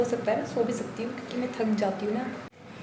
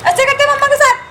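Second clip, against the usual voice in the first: playful scolding; 12 dB louder than usual. Real, not acted. Mother scolding baby for hair pulling.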